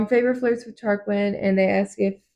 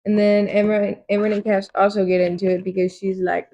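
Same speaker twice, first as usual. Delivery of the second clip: normal talking voice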